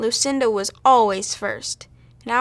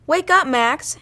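higher, louder wake-up call; raised-voice narrated shout; mild shout; 5 semitones higher, 5 dB louder, slower than usual. A narrator's reading. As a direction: call out to rouse a sleeper. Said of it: "she shouted at her sleeping cat".

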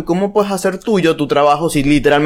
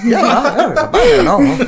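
laughing; 11 semitones higher, slower than usual. Real, not acted. Cracking up mid-argument.

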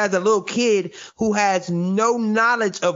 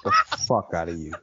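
very low, calm deep threat; 12 semitones lower than usual. Retold or imitated.

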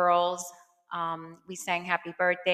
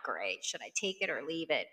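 low, quiet aside; mock reluctant acceptance; 4 semitones lower, 9 dB quieter than usual. Daughter receiving mom's advice, mock reluctant. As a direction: drop it down, underwhelmed.

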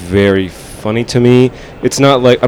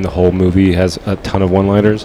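ordinary talk

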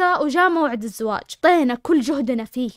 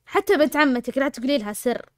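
ordinary delivery

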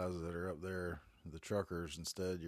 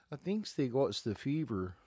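higher, louder imitation of a Canadian-sounding movie girl; lightly mocked Canadian lilt, pitched up; almost-Canadian accent; 6 semitones higher, 5 dB louder than usual. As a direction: mimic a little girl's near-Canadian accent. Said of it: "her accent cracked me up".

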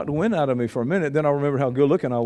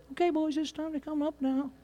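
a much softer, higher, doubtful call; timid call; 12 semitones higher, 11 dB quieter than usual. Shown as a demonstration. Archangel calling the dead, a soft, timid voice. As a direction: call them up as if it might fail. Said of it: "he's afraid it won't happen".

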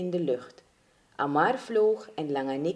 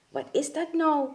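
high, startled question; mildly shocked exclamation; 8 semitones higher than usual. Acted. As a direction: ask it taken aback, voice jumping up. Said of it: "reageerde Carita geschrokken".

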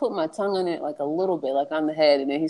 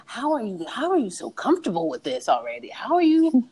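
incredulous; 3 semitones higher than usual. Retold or imitated. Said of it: "I was just like".

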